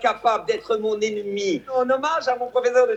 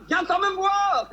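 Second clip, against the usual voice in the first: high bear voice; 10 semitones higher than usual. Retold or imitated. Bear voice higher.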